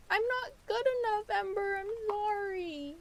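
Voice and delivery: high whiny fake sob; mock crying. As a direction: wail it like a fake cry. Mock crying apology to cat.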